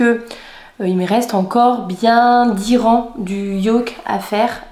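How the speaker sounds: ordinary talk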